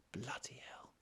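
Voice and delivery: hushed disbelieving whisper. Real, not acted. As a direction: breathe it out in awe. Whispered repeat of exclamation.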